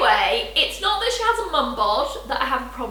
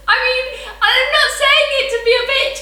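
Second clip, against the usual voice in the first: normal talking voice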